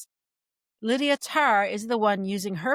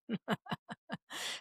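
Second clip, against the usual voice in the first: quiet, voiceless chuckle; soft chuckle; 3 semitones higher, 13 dB quieter than usual. Real, not acted. Podcaster amused that she makes them money, quiet chuckle. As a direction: laugh softly under the breath.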